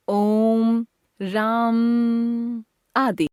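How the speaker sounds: प्लुत स्वर, ओम और राम को बहुत खींचकर; stretched pluta vowels. Shown as a demonstration. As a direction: hold the ओ in ओम and the आ in राम like a chant, very slow. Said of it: "ह्रस्व से तिगुना समय लगता है".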